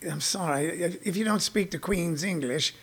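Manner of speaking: hushed, haughty British put-on; very soft, condescending mock British; snooty British accent. Comic as Briton rebuking a puzzled American, snooty British. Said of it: "They go".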